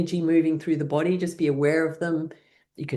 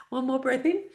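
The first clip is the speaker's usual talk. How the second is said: higher, giggling breath cue; laughing through the words; 11 semitones higher than usual. Real, not acted. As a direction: say it while still laughing.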